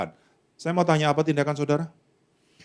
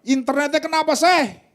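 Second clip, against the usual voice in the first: mock yell from room; 9 semitones higher than usual. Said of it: "teriak dari kamar".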